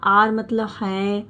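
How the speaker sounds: ordinary delivery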